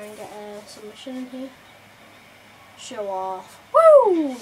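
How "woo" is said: loud high whoop; cheer. Real, not acted. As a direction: whoop at the screen. Cheering a move.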